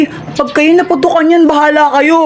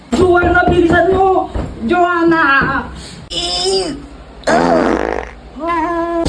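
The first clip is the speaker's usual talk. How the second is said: ordinary talk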